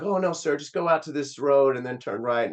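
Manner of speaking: playing his younger self sweetly helpful; eager polite kid. Teen retelling answering the driver.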